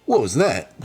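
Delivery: mock-amazed whoa, pitch swooping up. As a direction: imitate a hammy, startled movie reaction. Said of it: "reactions from [name] watching things".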